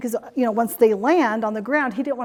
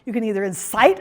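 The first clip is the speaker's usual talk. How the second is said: ordinary talk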